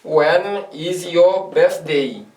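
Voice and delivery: each word drawn out for the class; very slow drill. Shown as a demonstration. Teacher repeats the question very slowly for beginners to follow. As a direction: stretch it out word by word. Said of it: "j'ai dit".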